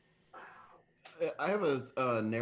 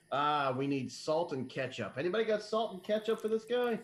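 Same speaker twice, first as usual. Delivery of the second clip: raised, higher voice asking around for help; calling out; 8 semitones higher, 3 dB louder than usual. Acted. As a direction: call out to the room, pitch climbing. Acts out calling out for supplies.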